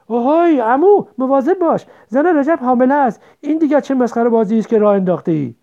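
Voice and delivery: confused friend angrily warning Qasem; angry. A narrator's reading. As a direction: scold him sharply for hugging the pregnant woman. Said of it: "با عصبانیت گفت".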